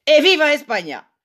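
high, loud exultant bellow; brullen. A narrator's reading. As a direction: bellow it like a cheer. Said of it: "brult Sint". (luid brullend)

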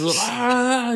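high, loud drawn-out "ah"; mock crazy scream. Man going crazy while tackled, high mock scream. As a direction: let out a hammed-up crazed yell. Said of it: "he's going crazy".

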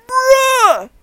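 high, loud outburst of disbelief; exasperated shout. Real, not acted. Reacting to sister blaming the chip, exasperated yell.

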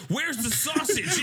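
high, slow, drawn-out sing-song demand; mock exasperated demand. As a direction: stretch it out exasperated. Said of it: "And she was like".

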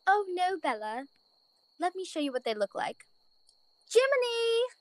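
comfort, then call out into the night; gentle bunny mother. Acted. Mother voiced, calling cricket.

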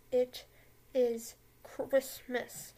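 "it is Christmas" with a long, drawn-out "is"; stress on is. Acted. Insisting to listeners that it is Christmas, stretched stress on "is". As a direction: lean hard on "is" and stretch "Christmas".

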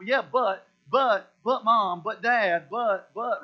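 put-on loud, slow childish whine of but, but; whiny kid. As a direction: plead like a kid caught out, dragging each but. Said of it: "You know how to go".

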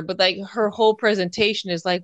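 normal talking voice

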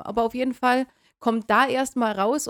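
usual speaking voice

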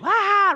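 put-on sudden high loud squeal of a kid leaping; high child's squeal. Child jumping into the pool, squealing high and loud. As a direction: shriek like a child jumping into water.

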